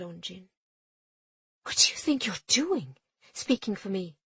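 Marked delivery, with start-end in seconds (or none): 1.6-4.2 captain confronting her mate; angry challenge. A narrator's reading. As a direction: round on him.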